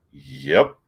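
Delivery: low-pitched deadpan retort; Wolverine. Retold or imitated. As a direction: keep the acted reply low and terse. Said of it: "Wolverine goes".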